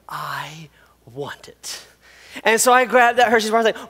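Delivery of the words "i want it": slow, hushed whisper full of longing. Retold or imitated. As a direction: breathe it out like a secret wish. Child craving candy bar.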